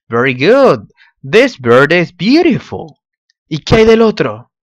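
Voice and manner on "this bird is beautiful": admiring. Acted. Praising the pretty bird.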